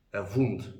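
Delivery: "wound" with a Russian v for the w; Russian accent. Shown as a demonstration. Teacher repeating the Russian example, Russian-accented. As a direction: say "wound" as a Russian speaker would. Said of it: "People with Russian accent may say it as".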